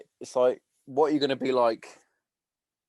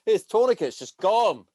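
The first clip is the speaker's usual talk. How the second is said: mock instructor; 8 semitones higher, 6 dB louder than usual. Mocking the female medic instructor.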